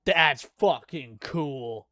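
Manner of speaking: ordinary talk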